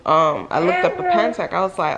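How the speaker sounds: normal talking voice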